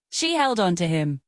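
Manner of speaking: ordinary delivery, nothing marked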